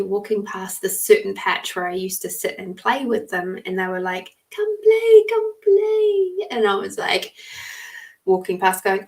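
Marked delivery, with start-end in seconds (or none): 4.5-5.2 very high, loud sing-song fairy call. Retold or imitated. Fairies calling the little girl to come play, high sing-song. 5.3-6.5 fairy voice calling. Retold or imitated. Fairies calling child to play.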